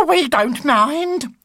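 high, loud, slow granny chorus; crying old ladies. Acted. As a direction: gush like adoring grannies. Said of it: "cried the three old ladies".

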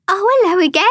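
high, loud chirpy greeting from a toad; high-pitched voice. A narrator's reading. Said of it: "came a high-pitched voice".

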